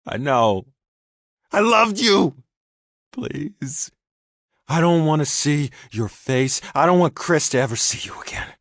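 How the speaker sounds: high, loud, breaking with rage and grief; anguished shouting. A narrator's reading. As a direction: shout it through tears. Micah begs, Daniel rages.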